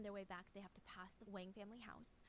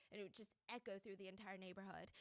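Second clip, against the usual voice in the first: usual speaking voice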